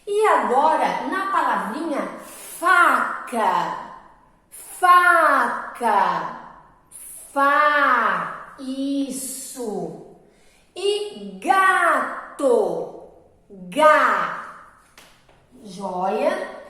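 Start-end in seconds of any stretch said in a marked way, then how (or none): 2.6-8.6 "faca" with a loud, long FAA; stress on first syllable. Shown as a demonstration. Teacher showing pupils the strong syllable, loud drawn-out stress. 11.4-14.4 "gato" very slow, GAA-to; stress on first syllable. Shown as a demonstration. Teacher giving the next example word, slow emphatic first syllable.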